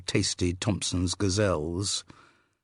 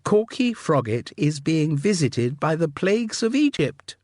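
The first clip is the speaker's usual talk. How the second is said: high, loud, jolly upper-class voice; breezy aristocrat; 9 semitones higher, 5 dB louder than usual. Acted. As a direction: gush like a delighted society girl. Upper-class sister character voice.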